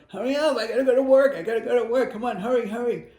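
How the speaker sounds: mock-frantic late for work; impatient mock rushing. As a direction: nag them to hurry, mock-frantic. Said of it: "Instead of going".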